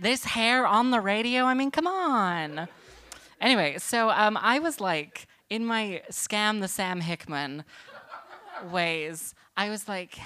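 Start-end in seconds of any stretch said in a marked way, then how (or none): none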